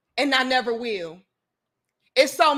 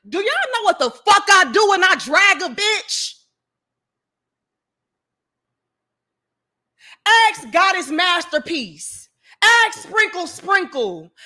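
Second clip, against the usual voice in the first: angry callout; 6 semitones higher, 4 dB louder than usual. Real, not acted. Heated callout of rivals.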